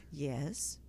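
low, soft imitation of a shy man; soft-spoken. Host mimics shy musician answering her, soft-spoken. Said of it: "he talks so soft".

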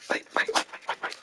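quick whispered wait wait wait; urgent waits. Real, not acted. Gamer halting the game in a hurried whisper. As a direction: hurry to stop everything.

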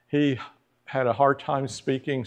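normal talking voice

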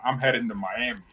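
normal talking voice

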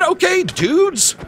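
slow, high, laid-back greeting; casual drawled hello; drawn-out okay dudes. Retold or imitated. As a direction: stretch it out to the dudes. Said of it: "I said".

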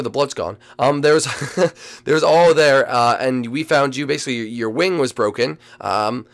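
ordinary talk, nothing marked

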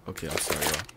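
ordinary talk

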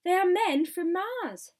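loud, high-pitched cry of discovery; excited crowd shout. A narrator's reading. Onlookers shouting about visitors from Mars, excited shout. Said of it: "they shouted".

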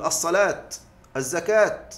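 الصلاة and الزكاة ending in a clear t; singular pausing on t. Shown as a demonstration. Teacher showing a rare usage, الصلاة and الزكاة stopped on t. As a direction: say "as-salaat, az-zakaat" for الصلاة، الزكاة. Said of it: "قل الوقف على المفرد بالتاء".

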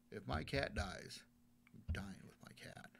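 slow, soft, whispered vow; whispered despair. Retold or imitated. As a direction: breathe it out, barely audible. Retold suicidal thought.

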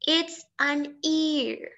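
put-on very slow, each word laid out for children; slow model answer. Shown as a demonstration. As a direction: spell out the answer slowly for the class.

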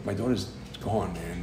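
inner thought. Retold or imitated. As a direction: voice a private thought, almost to yourself. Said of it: "I'm thinking to myself".

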